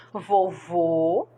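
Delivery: "Vovô" with a closed o in the stressed last syllable; closed final o. Shown as a demonstration. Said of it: "acento circunflexo, som fechado".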